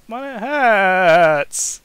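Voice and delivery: put-on loud, high childish whine; pathetic whine. Daniel whining to Kane, pathetic whine. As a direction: whine it pitifully. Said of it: "he whined pathetically".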